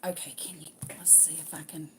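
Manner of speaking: whispered self-talk; hushed whisper while busy. Real, not acted. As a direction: murmur it voicelessly to yourself. Muttering while fiddling with phone.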